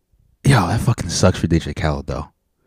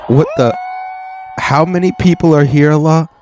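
raised, exasperated plea to the heavens; pleading to God; 8 semitones higher than usual. Retold or imitated. Performer pleading with God over a silent crowd, exasperated. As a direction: appeal upward in mock despair. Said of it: "looking up to God like".

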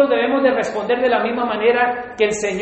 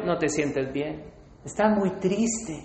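low soft tempter; 6 semitones lower, 8 dB quieter than usual. Retold or imitated. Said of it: "Y el tercero".

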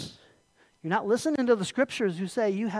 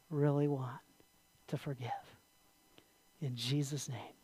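closing prayer. Real, not acted.

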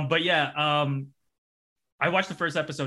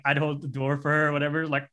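normal talking voice

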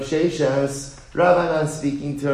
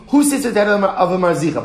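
loud, higher mimicry of the insult; mock indignant quote; 5 semitones higher, 5 dB louder, faster than usual. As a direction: repeat the jab, offended. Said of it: "he's all up in arms".

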